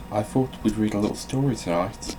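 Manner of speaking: normal talking voice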